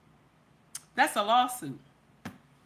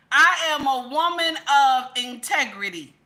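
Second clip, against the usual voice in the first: ordinary talk, nothing marked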